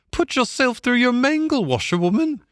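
very high barge woman's taunt; called-out jeer. A narrator's reading. Barge woman taunting Toad from the barge, high, called out. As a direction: call it teasingly in a high woman's voice. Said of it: "she called out".

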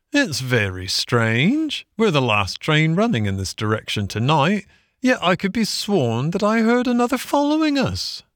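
lilting workman's voice rising high; puzzled engine driver. A narrator's reading. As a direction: puzzle aloud over something odd.

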